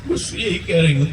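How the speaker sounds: normal talking voice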